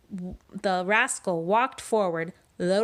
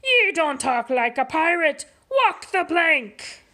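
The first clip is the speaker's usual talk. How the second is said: pirate order; 9 semitones higher, 5 dB louder than usual. Acted. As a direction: bellow the sentence at him. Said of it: "shouted Pete".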